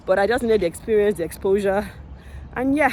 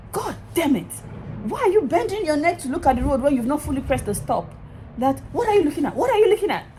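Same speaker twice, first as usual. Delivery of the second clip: retold shout; 6 semitones higher than usual. Instructor shouting at the learner at a stop, high mock yell. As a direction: bark it at the student like a furious instructor. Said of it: "If you see the way he shouted".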